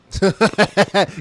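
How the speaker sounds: quick burst of real laughter; amused laugh. Real, not acted. Host reacting to witness dodging a question, amused laugh. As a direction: laugh at a blatant dodge.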